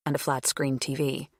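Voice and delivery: ordinary delivery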